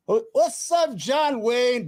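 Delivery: mock greeting. Real, not acted. Taunting chat guest as John Wayne.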